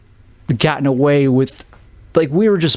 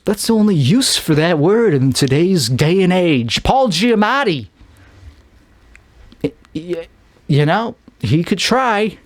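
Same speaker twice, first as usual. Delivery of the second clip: usual speaking voice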